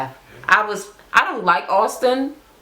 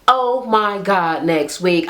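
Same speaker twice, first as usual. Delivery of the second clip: slow, deliberate; slower than usual. Real, not acted. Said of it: "I cannot wait".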